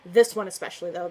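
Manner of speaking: normal talking voice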